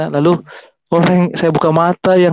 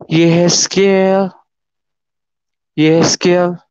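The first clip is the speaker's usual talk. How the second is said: slow, drawn-out summoning call; big but gentle voice; much slower than usual. Retold or imitated. A mysterious voice calls him by name, big gentle call. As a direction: stretch the name out, unhurried, without shouting. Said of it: "suara yang begitu besar, tapi tidak keras".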